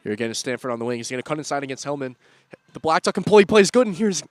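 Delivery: loud, high commentary building to a cry; excited play-by-play. Real, not acted. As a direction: ride the attack with rising excitement. Live play-by-play on the wing.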